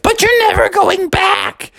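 very high, mock shout; high cartoon cub yell; Junior Bear yelling. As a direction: squeal it at him. Junior Bear yells back.

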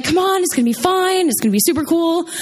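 high, coaxing sing-song; pushy friends. Retold or imitated. Friends urge me into the factory, coaxing and high. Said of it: "they were like".

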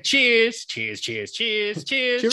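high, chirpy British cheers; mock British. Imitating British people saying goodbye, mock British accent. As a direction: pipe it up like Brits signing off. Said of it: "bunch of British people saying".

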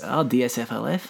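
soft, low attempt at Scottish; lightly put-on Scottish accent; a hint of mock Scottish. Shown as a demonstration. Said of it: "that's the best Scottish accent I've ever done".

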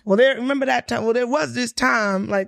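hammed-up Southern accent, like an old relative spinning a yarn; fake Southern accent. Telling future kids family stories in a fake Southern accent. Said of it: "Are you gonna put on that fake Southern accent".

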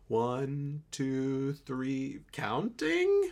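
halting count, swooping up into a question; mock baffled guess, last word shooting up; imitating confused guessing. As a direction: guess word by word, ending in a high squeak. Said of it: "and she's like".

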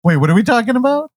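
high-pitched mock confusion; Clark. Co-host mimicking his partner losing track, high confused voice. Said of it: "Clark is like".

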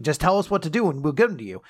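normal talking voice

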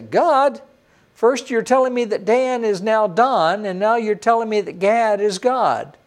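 high, loud mock objection; mock protest. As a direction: play the baffled student crying foul. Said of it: "you say".

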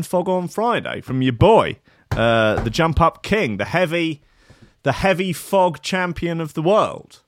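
big up the next show like a radio MC; hype announcer. Acted. Radio host hyping the Friday show in an announcer voice. Hyped show sign-off intro.

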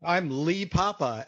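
normal talking voice

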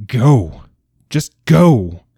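loud and high, rising on just go; shouted dismissal. A narrator's reading. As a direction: cast him out with a cry. Grieving father drives king away.

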